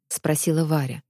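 ordinary delivery

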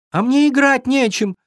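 high-pitched, hurt little-deer voice; offended fawn. A narrator's reading. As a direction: protest high like a wronged child. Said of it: "обиделся оленёнок".

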